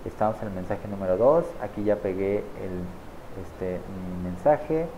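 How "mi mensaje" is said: ordinary talk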